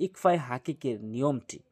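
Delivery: ordinary talk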